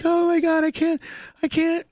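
high falsetto mock wail; imitating crying fiancée. Husband mimicking his fiancée's tearful panic in falsetto. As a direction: squeak it like a weepy fiancée, hammed up. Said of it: "my wife starts crying instantaneously".

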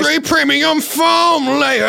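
high, drawn-out shout; shouted hype echo. Pretend. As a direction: stretch it out like a hype man. Hype man echoes the ad line.

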